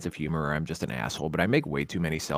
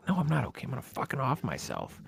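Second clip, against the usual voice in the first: mock despair; 6 semitones higher, 6 dB quieter than usual. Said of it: "I'm like".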